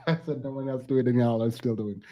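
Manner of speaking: quick, soft, laughing through the words; amused exasperation, laughing it out fast; rushed soft laughing complaint; laughing disbelief. Real, not acted. Viewers ignore her request.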